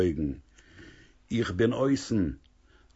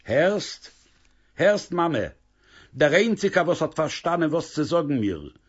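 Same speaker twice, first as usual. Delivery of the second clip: lightly voiced young woman, higher, pouring her heart out; a daughter defending her happiness, a bit higher; higher young woman's earnest plea; young daughter Basha Beila; 7 semitones higher, 3 dB louder than usual. Daughter insists to mother.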